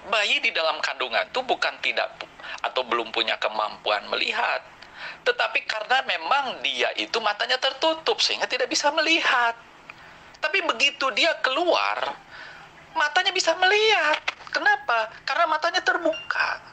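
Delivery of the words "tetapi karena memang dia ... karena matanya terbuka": heated rising argument. Real, not acted. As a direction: push each phrase higher to hammer the argument home. Heated debate point, voice climbing. (nada makin tinggi dan keras saat berdebat)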